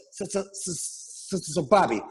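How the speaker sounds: stammered, halting repeats before the name; imitated stutter. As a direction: get stuck softly on one word, mimicking him. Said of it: "He starts stuttering again".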